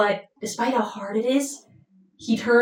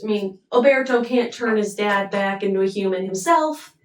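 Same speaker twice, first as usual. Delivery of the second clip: usual speaking voice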